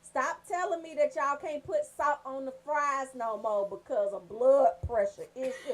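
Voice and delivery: ordinary talk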